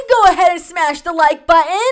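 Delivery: very loud, high hammed-up shout; mock plug. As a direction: belt it out like an over-the-top call to action. Parody of a creator's plug.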